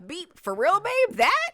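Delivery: high, loud incredulous questioning; mock outrage. Wife catching husband beeping at someone, mock incredulous outrage. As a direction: act scandalized, pitch shooting up. Acting out jealous spouse hearing beeps.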